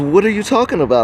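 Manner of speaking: high, fast, indignant woman's voice; defensive woman. Retold or imitated. Sister snapping back at the man who confronted her, high and fast. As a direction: toss it back quickly like a startled woman.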